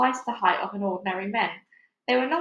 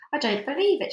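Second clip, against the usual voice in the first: higher, raised voice; mild shout; 6 semitones higher than usual. A narrator's reading. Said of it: "shouted the centipede, raising his voice".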